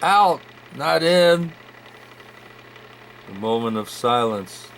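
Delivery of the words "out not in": muffled voice. Acted. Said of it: "the other voice said".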